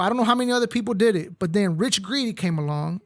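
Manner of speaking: ordinary talk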